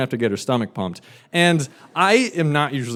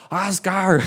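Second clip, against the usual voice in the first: mock yell; 6 semitones higher than usual. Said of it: "This woman starts yelling across the entire restaurant".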